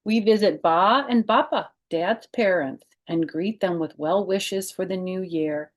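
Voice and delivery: lively read-aloud storytelling voice; children's book narration; story narration. Acted. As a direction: read it to kids, bright and clear. Reads picture book aloud.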